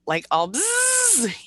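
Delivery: high slow buzz sound; a high, drawn-out mock buzz; buzzing noise. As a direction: buzz it out like a hyped bee. Describing buzzing energy from writing.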